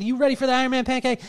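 dad hyping his son for breakfast, excited; hyped-up question. Retold or imitated. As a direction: hype him up like a game-show host. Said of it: "I said".

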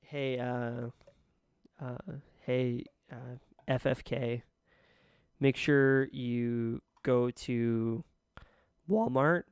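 cracked voice breaking upward; voice cracking. Real, not acted. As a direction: voice cracks on Walmart. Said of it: "I know my voice cracked when I said that".

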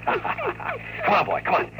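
high, coaxing call with a laugh; coaxing the dog. Real, not acted. Owner coaxing his dog to come along, high and coaxing. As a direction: coax the dog to follow you.

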